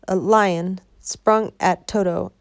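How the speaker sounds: usual speaking voice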